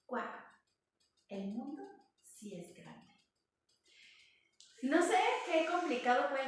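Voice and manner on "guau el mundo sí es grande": put-on wistful old kangaroo. Lonely grown kangaroo peeking from a tree, quiet and sad. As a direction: murmur it quietly from the tree hollow. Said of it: "se escucha su voz diciendo".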